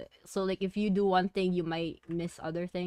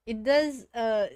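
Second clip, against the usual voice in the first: flustered laughing; 6 semitones higher, 5 dB louder than usual. Real, not acted. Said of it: "so flustered".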